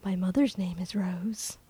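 hushed whisper of wonder. Retold or imitated. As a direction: breathe it as a secret. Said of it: "So he's like".

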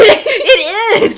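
high, loud laughing protest; laughing insistence. Real, not acted. Fan defends her favorite movie's romance, laughing loudly. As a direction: fire it back through laughter.

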